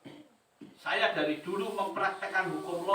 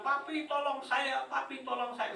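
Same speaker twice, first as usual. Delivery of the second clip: mock a frantic call for help, pitched up; imitated panicked cry; 9 semitones higher than usual. Congregant phones his pastor for help at night, mock panicked cry. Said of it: "Dia teriak-teriak, dia bilang".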